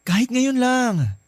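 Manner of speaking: high, slow begging; pleading. Acted. Man begging the married woman on the phone, slow and high. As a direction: stretch the plea out, high and needy.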